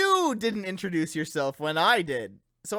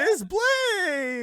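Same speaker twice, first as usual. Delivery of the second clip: high ghostly wail; 12 semitones higher than usual. Acted. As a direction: moan like a spooky ghost. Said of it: "spooky ghost voice".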